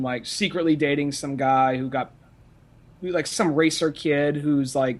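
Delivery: ordinary talk, nothing marked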